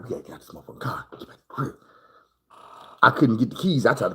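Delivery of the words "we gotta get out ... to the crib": fast, hushed, panicked rush; panicked whisper. Retold or imitated. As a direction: scramble to get away unheard. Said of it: "We panicking like".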